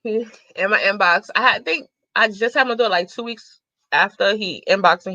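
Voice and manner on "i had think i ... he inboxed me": ordinary talk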